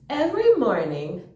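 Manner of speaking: ordinary delivery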